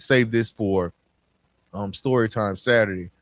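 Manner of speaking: ordinary delivery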